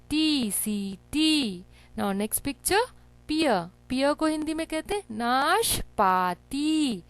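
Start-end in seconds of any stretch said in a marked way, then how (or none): 0.1-1.6 टी, then सीटी slowly with long "ee" vowels; syllable sounding-out. Shown as a demonstration. Teacher spelling for children, टी, सीटी slow and drawn out. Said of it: "टा में ई की मात्रा". 5.2-7.0 नाशपाती in drawn-out syllables, long final ती; long ई sound. Shown as a demonstration. Teacher showing children the sound, नाशपाती syllable by syllable. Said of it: "ता के साथ ई का साउंड".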